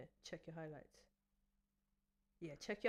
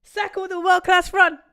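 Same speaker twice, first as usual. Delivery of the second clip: much higher, much louder praise; excited commentary; 10 semitones higher, 20 dB louder than usual. Real, not acted. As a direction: shout the run like a commentator. Live commentary on a run.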